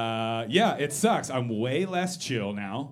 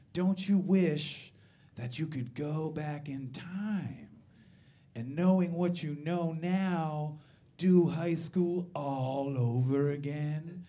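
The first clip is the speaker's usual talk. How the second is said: wistful friend; 4 dB quieter, slower than usual. Retold or imitated. Wistful friend quoted slowly.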